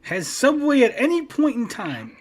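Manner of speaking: loud opener, then high, slow, emphatic; exasperated appeal. Real, not acted. Host asking his co-host to back him, exasperated. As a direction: demand backup, stretching out every phrase.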